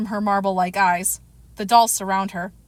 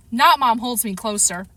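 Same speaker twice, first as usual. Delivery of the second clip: plain reading voice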